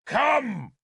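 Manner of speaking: high, loud summoning shout. A narrator's reading. Arthur summons a god from the cliff, shouting. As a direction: call the god out over the sea wind.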